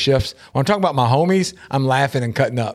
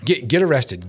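ordinary talk

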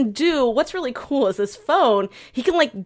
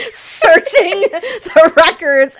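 loud, high, drawn out through laughter; laughing mock drama; 9 semitones higher, 9 dB louder, much slower than usual. Co-host acting out the phone's computer hack, laughing. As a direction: stretch it out slowly while cracking up.

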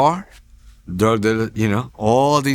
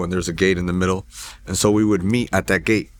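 ordinary talk

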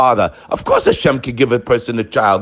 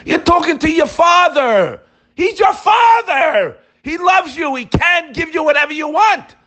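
preaching shout; 13 semitones higher than usual. Acted. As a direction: cry it out to the whole shul. Preacher crying out about Father.

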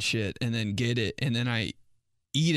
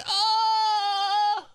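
high exaggerated shriek; mock shriek; 5 dB louder than usual. Daughter shrieking at dad's remark. Shocked reaction to dad.

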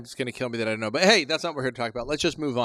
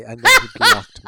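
laughing punchline; 11 dB louder than usual. Real, not acted.

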